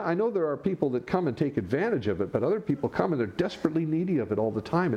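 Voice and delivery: ordinary talk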